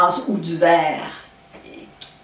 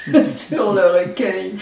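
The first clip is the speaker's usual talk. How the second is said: laughing at herself while saying it; amused, chuckling through the admission. Real, not acted. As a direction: rire gêné en avouant qu'elle ne lit pas le chinois. Admits she cannot read Chinese.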